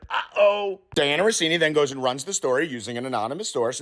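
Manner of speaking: ordinary delivery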